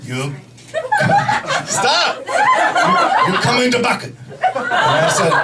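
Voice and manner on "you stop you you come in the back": put-on halting Japanese-accented doctor; mock Japanese accent, words in bursts; imitated Japanese accent. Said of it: "this is how he spoke".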